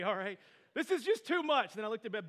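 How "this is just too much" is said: loud, raised-pitch exasperation; angry, fed up. Retold or imitated. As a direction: act out a parent at breaking point. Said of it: "I was clearly angry".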